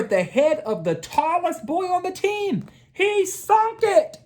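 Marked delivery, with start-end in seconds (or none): 2.9-4.2 high, slow and drawn out in triumph; high, slow storyteller triumph; triumphant. A narrator's reading. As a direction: savor the winning shot. Climax of the game.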